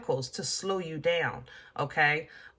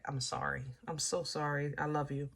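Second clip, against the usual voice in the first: much softer, gentle apology under her breath; 3 semitones lower, 10 dB quieter than usual. Real, not acted. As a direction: ease the blow quietly. Apologising after hard news.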